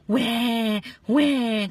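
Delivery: loud imitated child's wail; mock bawling. A narrator's reading. Tinku refuses to share, loud mock bawling. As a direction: act out a noisy storybook cry. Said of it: "करके जोर जोर से रोने लगा".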